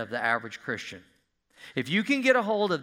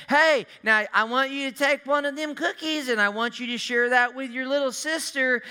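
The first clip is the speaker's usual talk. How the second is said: bright, higher coaxing parent voice; a parent sweetly asking a kid to share; parent instructing child; 9 semitones higher, 4 dB louder than usual. Retold or imitated.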